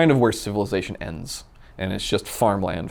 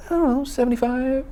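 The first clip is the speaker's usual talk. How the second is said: much higher, mock-innocent mother's voice; imitating mom. Mom guessing their speed on the bike, high mock voice. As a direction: squeak it out like a clueless mom guessing. Son asks mom on motorcycle.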